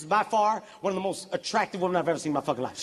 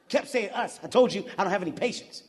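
ordinary talk

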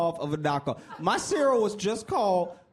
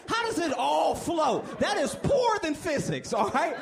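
exasperated outcry; 10 semitones higher than usual. Acted. Comic exasperated about floating cereal.

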